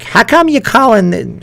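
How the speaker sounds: wife imitated in a high New York accent. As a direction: put on a high-pitched New Yorker voice for the wife. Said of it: "why does she talk like a New Yorker".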